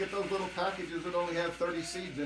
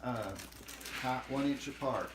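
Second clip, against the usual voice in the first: reading the package; 2 semitones lower, 5 dB quieter, slower than usual. Retold or imitated. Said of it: "The package says".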